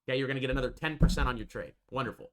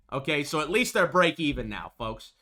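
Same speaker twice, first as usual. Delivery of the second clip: ordinary delivery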